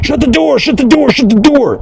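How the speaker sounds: lightly voiced, very high mock demand; mock high-pitched order. Mocking the man who tells him to leave, high mock voice. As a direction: squeak the order out like a cartoon boss. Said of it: "You go".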